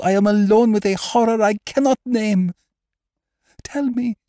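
very high, anguished voice rising to a loud peak; desperate pleading. Acted. Haunted woman begging her doctor for hope, high and desperate. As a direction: plead in mounting terror.

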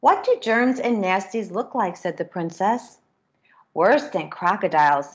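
lively storybook reading with character voices; reading to children. A narrator's reading. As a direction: read it brightly to a class of kids. Reads picture book to children.

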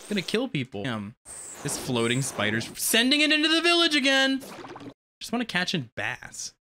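ordinary delivery

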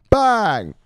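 high, loud punch; one loud high burst; shouted catchphrase. Pretend. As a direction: fire it off like a starting gun. Catchphrase shouted after each name.

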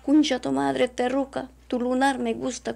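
imitating the abuser's loud taunt; retold abuser's insult. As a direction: sneer his words back, loud. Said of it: "Y me dice".

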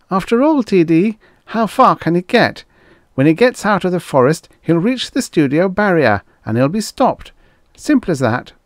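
high, loud underling voice; reassuring assistant. A narrator's reading. Assistant reassures the producer, high-voiced. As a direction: pitch it up to placate the boss.

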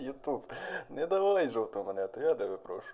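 soft, half-laughing plea to YouTube; mock plea. Real, not acted. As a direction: beg quietly, amused. (тихе жартівливе благання)

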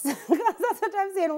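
said through a laugh, slow. Real, not acted. Laughing to the host about the counselor. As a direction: laugh while saying it.

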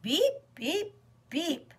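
high little horn beeps; small truck's cry for help in horn sounds; Little Blue Truck. A narrator's reading. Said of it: "cried Little Blue Truck".

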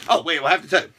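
ordinary talk, nothing marked

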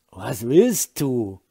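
put-on slow, slurred drunk mumble; drunken slur. A narrator's reading. Drunk Holger wakes and slurs at his wife. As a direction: slur it like a drunk barely awake. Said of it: "lallte er kaum verständlich".

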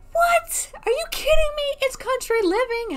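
high, squealing what, are you kidding me; outraged disbelief. Real, not acted. Defending her sim's overalls to the game, squealing in disbelief. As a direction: protest in shrill mock outrage.

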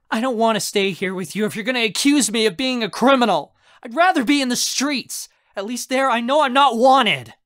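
high, loud, hurt outburst; tearful fury. Acted. Raz threatens leaving uncle's home, hurt and furious. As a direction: pour out anger close to tears.

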